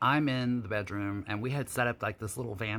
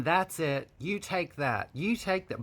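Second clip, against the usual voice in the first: corny and sappy; 5 semitones higher than usual. Retold or imitated. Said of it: "sounds, like, really corny and, like, soft and sappy".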